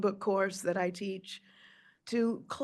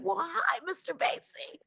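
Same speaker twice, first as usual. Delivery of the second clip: high, hushed whispered greeting; awed whisper; 10 semitones higher, 4 dB quieter than usual. Retold or imitated. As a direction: whisper it in awe of the star.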